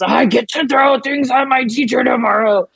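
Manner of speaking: a lightly voiced toddler pleading; frustrated little boy voice. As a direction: imitate a frustrated three-year-old whining. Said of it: "he just gets this little voice when he's really frustrated".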